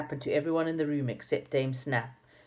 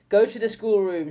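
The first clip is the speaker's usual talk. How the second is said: higher, louder command; stern order; 6 semitones higher, 7 dB louder than usual. A narrator's reading. Dame Snap ordering the line to the schoolroom, stern. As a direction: send them off sharply. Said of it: "said Dame Snap".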